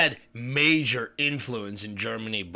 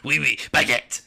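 mock French; 7 dB louder than usual. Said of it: "can you do it again one more time?".

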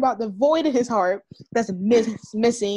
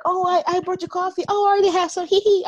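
higher, louder mock giggly voice; cutesy parody ending in a giggle; imitating Josie's awkward flirting; 8 semitones higher, 4 dB louder, faster than usual. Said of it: "like, the shit, like".

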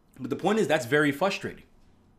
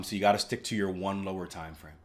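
ordinary talk, nothing marked

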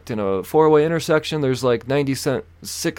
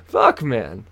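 ordinary talk, nothing marked

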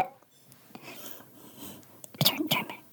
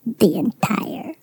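breathy secretive whisper; slow, hushed like sharing a secret; 3 semitones lower, 6 dB quieter, much slower than usual. Pretend. Fake expert whispering a secret to the host, hushed and slow.

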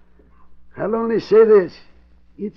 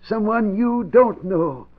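slow and deliberate; slower than usual. Acted. Old man calmly warns gangsters.

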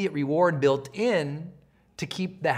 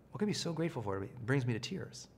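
softly reflective; 4 semitones lower, 13 dB quieter than usual. Shown as a demonstration. Silent reflection in meditation.

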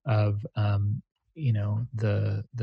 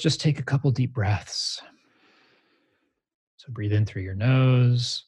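guided meditation. Acted. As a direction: guide them gently into stillness. Leads a meditation.

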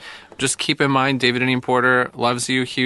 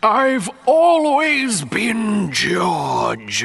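dramatic last words; 7 semitones higher, much slower than usual. Pretend. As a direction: wail it out like dying last words. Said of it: "were his last words".

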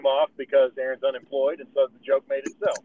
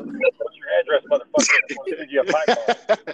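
mock creepy stalker; 8 semitones higher, 3 dB louder than usual. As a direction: drag out a threat like a cartoon stalker. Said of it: "You know?".

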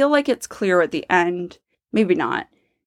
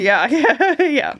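laughing; 8 semitones higher, 3 dB louder, slower than usual. Real, not acted. Laughing agreement.

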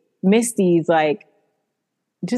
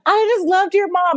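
high, falsely sweet; mock her fake innocence; imitating Misty's sugary innocence to mock her; 10 semitones higher than usual. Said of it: "She's like".

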